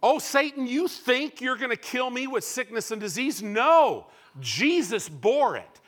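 loud, high confrontation of Satan; defiant rebuke. Acted. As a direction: throw the words at the enemy.

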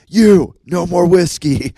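stern dad. Retold or imitated. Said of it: "And Dad was like".

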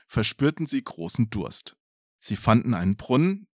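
plain reading voice

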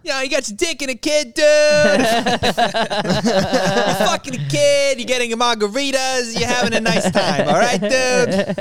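Bobby Kelly impression. Comic imitates Bobby Kelly on the island, high and rowdy. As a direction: pitch way up and cheer like a rowdy bro. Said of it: "Bobby Kelly on Jeffrey Epstein's island".

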